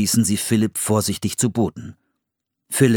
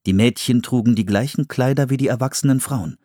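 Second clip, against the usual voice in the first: plain reading voice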